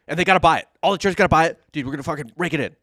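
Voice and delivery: loud, high, hyped-up pitch; excited shouting. Acted. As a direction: yell it like a crazed salesman. Pitching plan as cocaine Pope.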